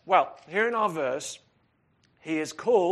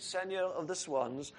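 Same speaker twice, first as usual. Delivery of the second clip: softer French-accented rendering; French pronunciation, understated and soft; 8 dB quieter than usual. Shown as a demonstration. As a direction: put on a French accent, more quietly. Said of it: "say that in a French accent".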